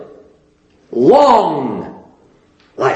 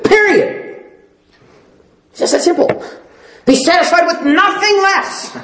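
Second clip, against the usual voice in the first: higher, slow, hammering pulpit cadence; full-force preaching; 8 semitones higher, slower than usual. Acted. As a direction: thunder the demand for obedience from the pulpit.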